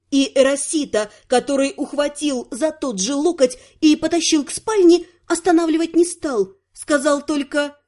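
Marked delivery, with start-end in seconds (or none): none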